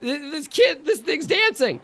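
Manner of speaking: put-on high-pitched awestruck child voice; amazed little kids. Little kids watching the dancing doll, high and amazed. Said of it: "these little kids are like".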